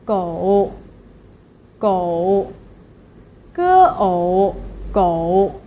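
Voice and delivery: "gou" sunk low in a curved dip, split as "ge ou"; third tone. Shown as a demonstration. Teacher drills the word for dog, low dipping "gou". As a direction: go down as low as possible on "gou", then bounce. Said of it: "low as you can and then bounce up".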